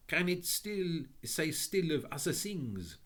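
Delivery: put-on high, French-accented lady's welcome. A narrator's reading. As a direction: greet them in a lilting French accent. French lady asks.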